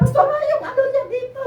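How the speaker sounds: the second plea, breaking between a laugh and a sob; laughing through tears. Retold or imitated. Asking Pastora about the baby, half laughing half crying. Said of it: "Dalawang beses talaga".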